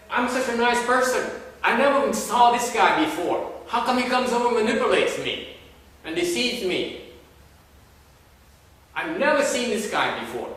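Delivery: loud, high-pitched aggrieved protest; mock outrage at full volume; upset. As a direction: play the wronged innocent, crying out in indignation. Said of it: "then you get upset and say".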